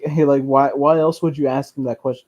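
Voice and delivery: normal talking voice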